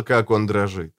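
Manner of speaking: ordinary delivery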